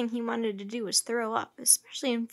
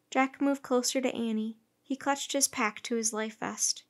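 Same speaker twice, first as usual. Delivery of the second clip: usual speaking voice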